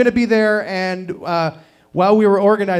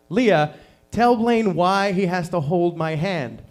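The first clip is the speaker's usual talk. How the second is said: slow, deliberate dad voice; measured parental instruction; slower than usual. Retold or imitated. Dad to six-year-old about her brother, slow patient instruction. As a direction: spell it out slowly for the children. Dad enlisting daughter to explain.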